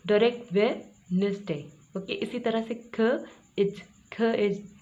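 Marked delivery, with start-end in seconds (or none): 0.5-1.7 वेडनेसडे as WENS-day, first D dropped; silent D. Shown as a demonstration. Teacher shows learners the rule, demonstrating silent D. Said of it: "पढ़ा नहीं जाएगा". 2.9-4.7 ख इज broken into K and IJ; silent D demo. Shown as a demonstration. Teacher spells out the sound for learners, demonstrating. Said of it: "कैज नहीं".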